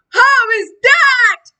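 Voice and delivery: put-on huge, slow, high booming voice from a tiny baby. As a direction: boom it out like a giant in a baby's body. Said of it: "she boomed".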